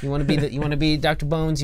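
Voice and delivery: ordinary talk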